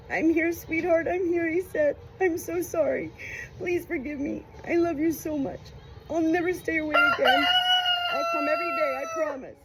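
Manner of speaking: high, tearful pleading; sobbing. A narrator's reading. Grandfather at dying woman's bedside, sobbing. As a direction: beg forgiveness through tears. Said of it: "he exploded into sobs".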